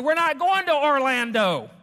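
loud, high-pitched outburst imitating an irate traveler; angry passenger's shout. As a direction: bellow it across the airport gate in protest. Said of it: "this guy shouts and says".

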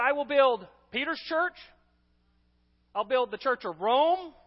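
deliberately wrong reading, stretched out in disbelief; mock misquote. Mocking false version of verse.

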